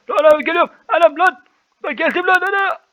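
high excited yelling; screaming. Real, not acted. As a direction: shriek while sliding down. Screaming down slide. (kayarken tiz çığlık)

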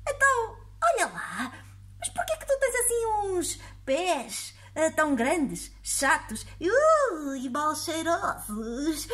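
put-on high, prying old lady voice; nosy old woman. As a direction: pester him like a nosy granny. Old woman questions the monster.

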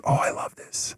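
soft whisper, quiet and pleased; whispered delight. Retold or imitated. Him answering his coach, in a soft whisper. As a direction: breathe it like a secret thrill. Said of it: "I was like".

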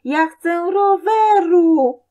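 high, very loud, drawn-out yell; tantrum scream. A narrator's reading. Boy on the floor screams for bicycle, full tantrum. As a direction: scream it like a child kicking the floor. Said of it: "wali nogami w podłogę i krzyczy".

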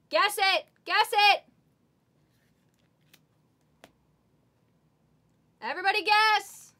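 rally the viewers to guess; urging shout. Real, not acted. Breaker urging chat to guess the card, loud call.

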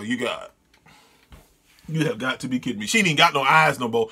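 ordinary talk, nothing marked

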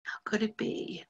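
barely voiced, stunned whisper. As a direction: breathe it out in disbelief. Stunned after the encounter.

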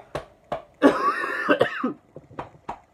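high, loud coughing fit; choking cough. Real, not acted. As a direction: cough hard on the wrong pipe. Choking on food.

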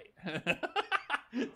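high burst of giggling; self-amused laughter. Real, not acted. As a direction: crack up at your own impression. Laughing at own bad impression.

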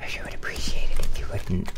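sarcastic whispered plea; whispering. Real, not acted. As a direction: hush it under your breath. Muttering at the game.

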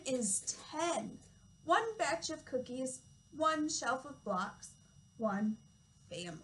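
slow, lilting read-aloud with each number drawn out; reading to children. Acted. Toddler teacher reading a counting book to children, slow storytime voice. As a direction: read it slowly and clearly for little ones.

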